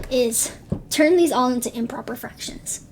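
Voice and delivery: losing her voice, half whispered; hoarse, voice nearly gone; raspy whisper. Real, not acted. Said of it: "Sorry, I'm losing my voice".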